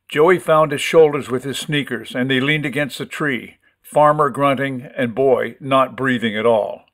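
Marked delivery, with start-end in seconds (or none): none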